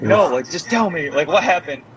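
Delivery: acted-out worried insistence; retold anxious pleading. Retold pleading with crying wife.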